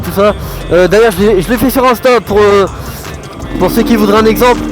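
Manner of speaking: talking loud inside a helmet while riding. Real, not acted. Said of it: "on se retrouve tout de suite dans le casque".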